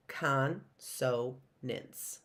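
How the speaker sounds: Consonants drawn out slowly, each syllable apart; stretched syllable by syllable. Shown as a demonstration. Teacher slowly sounds out Consonants for children. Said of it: "trying to say it where the stops are".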